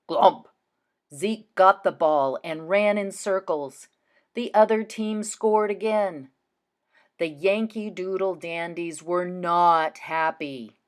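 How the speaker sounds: slow read with clear pauses at the commas; pausing at commas. Shown as a demonstration. Teacher reads slowly to show pauses at commas. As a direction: take your time and stop briefly at each comma. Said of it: "Listen as I read and pause in those spots".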